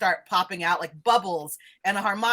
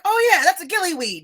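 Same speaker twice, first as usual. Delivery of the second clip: high, louder burst of recognition; eager recognition; 8 semitones higher, 5 dB louder than usual. Acted. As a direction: pipe up high like a delighted expert. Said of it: "he's like".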